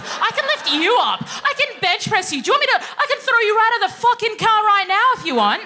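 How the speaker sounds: high, loud boasting rant; hammed-up outraged challenge; indignant mock threat. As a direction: build to a yelled comic threat. Acted threat to small colleague.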